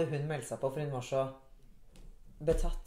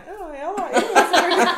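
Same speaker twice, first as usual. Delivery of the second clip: much higher, laughing agreeable voice; mock obliging Bettan; 13 semitones higher, 12 dB louder than usual. Mimicking Bettan agreeing to take the photo, high and laughing. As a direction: chirp yes up high. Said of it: "Hun bare".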